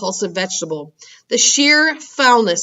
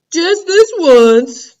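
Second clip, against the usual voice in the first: much higher and louder, very slow; slow giant drawl; 9 semitones higher, 9 dB louder, much slower than usual. Acted. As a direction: stretch it out greedily. Giant concedes slowly.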